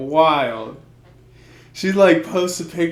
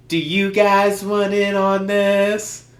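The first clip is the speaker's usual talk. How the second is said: mock girly voice; 6 semitones higher, 4 dB louder than usual. Girl voiced in a story.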